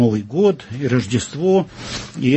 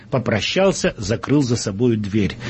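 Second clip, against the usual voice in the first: ordinary delivery, nothing marked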